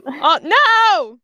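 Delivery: loud, high wail of dismay; mock dismay. Grape reacts to Shatton's promise, loud mock dismay. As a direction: cry out in exaggerated dismay.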